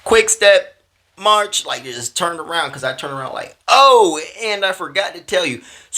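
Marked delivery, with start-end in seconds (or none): 0.0-1.7 loud, high, drawn-out marching command; Marine Corps drill call. Acted. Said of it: "I did the Marine Corps". 3.7-5.6 loud, high "Oh" easing down; sudden afterthought. Retold or imitated. Acts out turning back to her.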